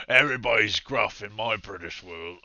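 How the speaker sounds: loud, hammed-up gruff British; gruff British accent. Shown as a demonstration. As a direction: put on a rough British voice. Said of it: "I only do that British accent. It's just gruff".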